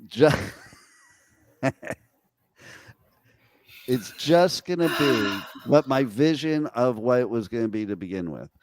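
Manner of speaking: ordinary talk, nothing marked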